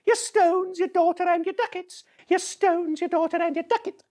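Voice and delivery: put-on high shrill taunting kids' chant; mock the old man like street boys; jeering street urchins.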